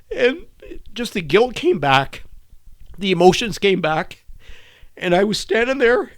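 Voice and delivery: high, tearful, halting delivery; choked with guilt. Real, not acted. Guilt returns while telling it.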